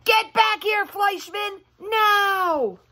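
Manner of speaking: high mock scream; a child shrieking from a tree. Lulu tied to a tree screams after Fleischman, high mock scream. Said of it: "She was screaming".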